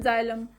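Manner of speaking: जाइलम starting with a z sound. Shown as a demonstration. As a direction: say जाइलम as "zy-lum". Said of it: "ज का".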